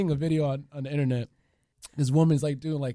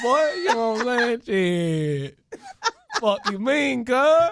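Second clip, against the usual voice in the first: put-on country accent; 7 semitones higher, much slower than usual. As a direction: holler it in an exaggerated country accent.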